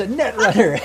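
repeating it through laughter; laughing delight. Real, not acted. GM laughing as the player confirms she's a netrunner. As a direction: savour the joke.

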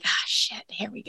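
hushed, whispered aside; mock-resigned near-whisper; imitated dread. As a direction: voice their reluctant thought under the breath. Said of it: "I see your faces. You're like".

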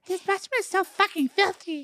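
very high puppet falsetto; Elmo. Acted. Cleaning a frat boy bathroom, complaining in Elmo voice. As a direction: swear in a squeaky puppet voice. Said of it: "But we're Elmo voice".

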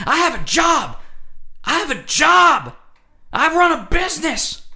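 high, loud indignant shout; mock drunk yelling. Drunk guy yelling in my face, mock indignant shout. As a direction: yell it like a boasting drunk. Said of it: "he's yelling things that don't even make sense".

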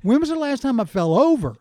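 loud, high disbelief; surprised. Real, not acted. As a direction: repeat the question in disbelief. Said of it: "repeats the question back".

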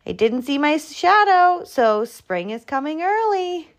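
high, rising excitement; high-pitched eager groundhog; excited announcement. A narrator's reading. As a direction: announce good news to a crowd. Groundhog excitedly tells his lie.

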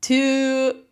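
very slow, loud put-on French accent; a hammed-up French mouse, stretching every word. Mouse patient shows his therapist a French voice, loud slow mock French accent. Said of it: "very good French".

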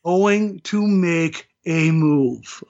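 drawn out word by word; deliberate, heavily spaced delivery; slow and emphatic. Real, not acted. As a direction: spell out the prediction very slowly. Slowly spells out his bold bet.

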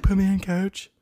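high, silly eager voice; goofy movie quote. Retold or imitated. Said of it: "and he's like".

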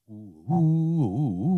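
slow, hooted "ooh" whistle motif; drawn-out imitation of a whistled tune; imitated whistle. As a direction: hoot it like an eerie Western whistle. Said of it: "the little whistles in there".

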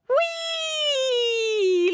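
squealed high-pitched whee; a delighted slide-down squeal. Acted. Monkey sliding down the tree, joyful high squeal.

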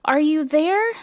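high searching call. A narrator's reading. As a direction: call out for lost tiny friends. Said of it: "searched them and called".

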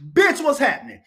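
high, loud shouted catchphrase; slogan shout. Shown as a demonstration. Host shouting his new slogan to viewers, loud and high. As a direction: yell the catchphrase out big. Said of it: "That's my new slogan".

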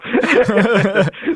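short high laugh; a quick real laugh. Real, not acted. Laughs at own joke.

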